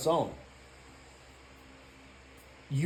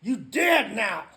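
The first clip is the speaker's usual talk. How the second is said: much higher, loud jeering yell, then slowing at the end; taunting shout; 12 semitones higher, 8 dB louder, slower than usual. Retold or imitated. Inner voice mocking his weak sober self, in a high jeering shout. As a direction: scream the taunt like a bully gloating. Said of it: "it's like".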